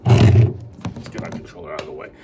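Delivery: a jokey deep-voiced aside; goofy deep voice.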